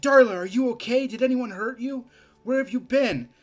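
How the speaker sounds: worried shout. Acted. Anthony runs to Darla, anxious and loud. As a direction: rush to her, frantic with worry.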